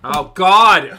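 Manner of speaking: loud shocked outcry; shocked shout. Real, not acted. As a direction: blurt it out in shock. Sudden shocked outburst.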